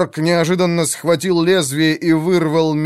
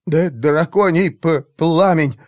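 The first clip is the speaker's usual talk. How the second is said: awed, slow, halting utterance; reverent stammer; 4 semitones higher, 3 dB louder, slower than usual. A narrator's reading. Mage offers the flask to commander, awed stammer. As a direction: stumble over the word in awe. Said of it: "благоговейно прошептал Райзер".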